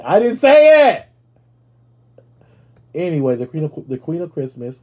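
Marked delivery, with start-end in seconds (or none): 0.0-1.1 high, loud, drawn-out protest; slow squealed denial; mock outraged shout. Real, not acted. Denying saying Illuminati.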